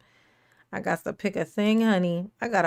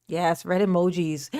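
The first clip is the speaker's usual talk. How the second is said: ordinary delivery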